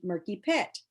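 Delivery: ordinary delivery, nothing marked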